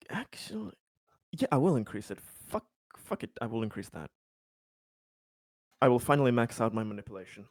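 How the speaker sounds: ordinary delivery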